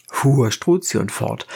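ordinary delivery

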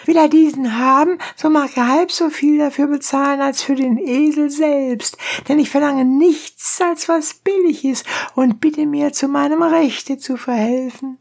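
very high, protesting donkey driver; high-pitched indignant peasant voice; crying donkey driver. A narrator's reading. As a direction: plead your case in a high cry. Donkey driver continues his complaint.

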